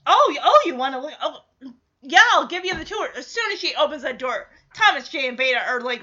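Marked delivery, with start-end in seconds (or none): 0.1-3.1 loud, bright hostess voice; excited Shelly voice. Retold or imitated. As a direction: show off the van eagerly. Shelly voiced showing off van.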